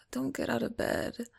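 normal talking voice

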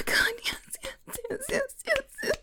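ordinary talk

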